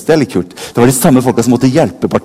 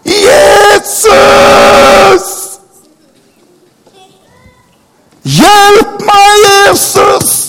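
desperate cry, far higher and louder, long held; crying out with all he has; 5 dB louder, much slower than usual. A narrator's reading. Blind beggar shouting to Jesus passing by, desperate. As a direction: call out to Jesus with everything you have. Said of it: "roper Bartimeus ut alt han har".

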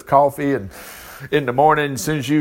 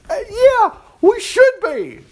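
much higher and louder, emphatic; high exclaim; 4 dB louder than usual. Real, not acted. Preacher answering the doubter, high and emphatic. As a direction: burst out high, insisting.

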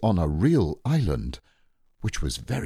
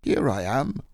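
lightly growly bear, raised pitch; 8 semitones higher than usual. A narrator's reading. Bear answering from behind, mildly growly. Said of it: "said a growly voice behind him".

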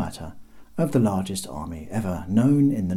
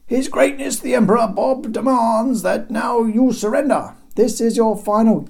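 much higher, louder grand proclaimer; pompous herald; 14 semitones higher, 7 dB louder than usual. Acted. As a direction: announce it like a royal crier.

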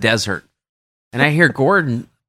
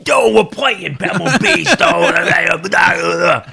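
put-on loud, higher hyped-up Boston accent; 4 semitones higher, 3 dB louder, much slower than usual. Caddie imitating stoked Boston golfers, loud Boston accent. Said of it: "super, like, Boston".

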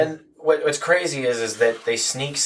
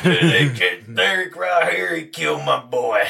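slow, drawling backwoods snake man impression; bayou snake handler; 3 semitones higher, slower than usual. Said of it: "as the snake handler".

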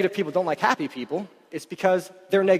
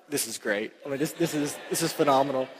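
quiet amazement; 4 semitones lower, 5 dB quieter than usual. Retold or imitated. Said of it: "and I'm like".

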